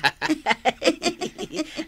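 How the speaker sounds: high-pitched giggle. Acted. Wife at the table with her husband, giggling. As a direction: let out a giggle.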